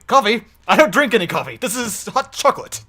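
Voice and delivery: indignant Oobleck insisting it's hot chocolate, not coffee; Oobleck impression. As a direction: play the wired teacher who only drinks hot chocolate. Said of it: "you sounded more like Oobleck there".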